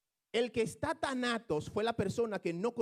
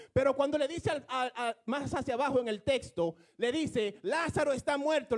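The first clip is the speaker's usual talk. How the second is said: heated preaching; 4 semitones higher than usual. Real, not acted. Explaining Lazarus to the church, heated preaching. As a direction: drive the point home to the congregation.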